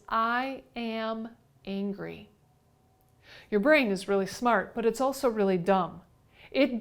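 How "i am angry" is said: slow, soft "I... am... angry"; each word set apart. Shown as a demonstration. Teacher quoting the listener's self-talk, slowly separated. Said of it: "What you said is".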